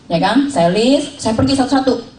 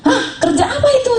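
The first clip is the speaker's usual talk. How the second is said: surprised father-in-law. Retold or imitated. Father-in-law asking, retold.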